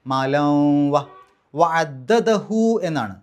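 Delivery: മാലം വ with the noon merged into a humming w; slow tajweed demo; nasal merge demo. Shown as a demonstration. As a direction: recite മാലം വ with a held nasal hum. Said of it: "ഇദ്ഗാം ബി ഗുന്ന".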